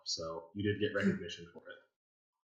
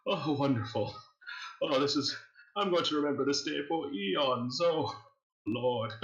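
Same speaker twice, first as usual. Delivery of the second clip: amused villain host; 6 semitones higher than usual. Acted. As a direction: savour the praise like a cruel aristocrat. GM host praises the player.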